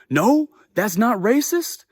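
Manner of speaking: loud, high, drawn-out comeback; mock the man's slow, loud indignation; imitated indignant retort. Man arguing about racism, imitated loud indignant voice.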